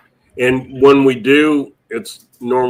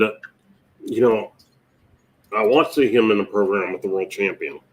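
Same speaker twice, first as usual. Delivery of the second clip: normal talking voice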